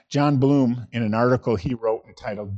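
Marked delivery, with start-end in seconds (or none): none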